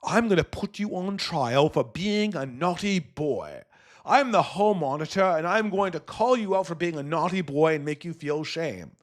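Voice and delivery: mock scolding hall-monitor voice; hammed-up telling-off of a naughty child; Piers Morgan. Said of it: "Piers Morgan's like".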